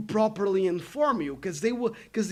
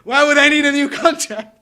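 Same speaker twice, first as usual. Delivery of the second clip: sarcastic anger; 6 semitones higher, 12 dB louder than usual. Retold or imitated. Storming out after the pointless visit.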